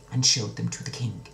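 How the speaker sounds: usual speaking voice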